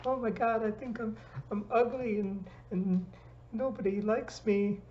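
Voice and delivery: high, upset wail of someone freaking out; imitating panic. Imitating a panicked friend who thinks nobody likes them, high and upset. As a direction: act out a friend spiralling in self-doubt.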